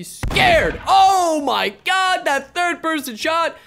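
loud, high, drawn-out outburst; shocked yell. Real, not acted. Sheriff scared by a third person shot, shocked yell. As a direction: yell it out, stretching each word.

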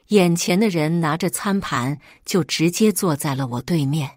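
ordinary delivery

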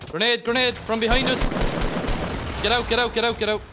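high, loud urgent yell; shouting warning. Real, not acted. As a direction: yell the warning, get them out. Warning squad of grenade.

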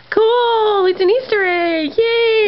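high squealing delight; excited. Real, not acted. Finding an Easter egg.

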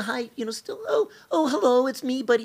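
put-on very high, crappy old-lady falsetto. Shown as a demonstration. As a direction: badly fake a famous actress way up in pitch.

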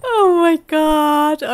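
slow, stretched words through laughter; laughed-out exclamation. Real, not acted. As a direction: sigh it out after cracking up. Recovering from a laughing fit.

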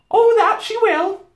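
high, loud, slow agreement; Missus Beaver. A narrator's reading. Beaver wife agreeing, high and drawn out. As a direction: agree heartily in a high housewife voice.

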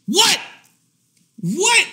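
high, incredulous yelp; exaggerated high-pitched shock. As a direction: pitch it right up in disbelief. Acted disbelief at her excuse.

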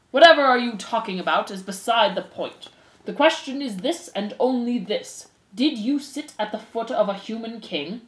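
preside with weighty, deliberate authority; stern head mouse. Acted. Head mouse puts rumors to the accused, stern and formal.

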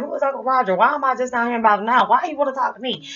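high-pitched string of questions; nosy kid firing off question after question; pestering questions. Retold or imitated. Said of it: "I'm asking fifty, eleven questions".

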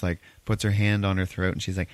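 usual speaking voice

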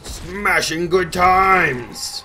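loud, high, slow mock war cry; drawn-out battle cry. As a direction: stretch it out in a big playful yell. Mock battle cry while smashing.

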